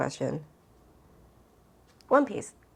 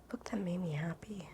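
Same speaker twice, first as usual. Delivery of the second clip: breathy whisper, much softer, thinking aloud; whisper to herself; 11 dB quieter than usual. Real, not acted. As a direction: whisper the question under her breath.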